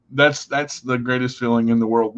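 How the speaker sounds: ordinary talk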